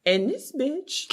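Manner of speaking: ordinary talk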